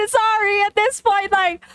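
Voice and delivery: high apology through laughter; crying with laughter. Real, not acted. Reader apologising to the writer, crying with laughter. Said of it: "I'm crying at the".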